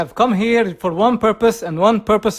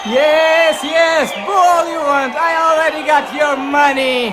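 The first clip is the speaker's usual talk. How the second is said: angry shouting; 3 semitones higher than usual. Acted. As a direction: yell down at the crowd. Ranting at booing crowd.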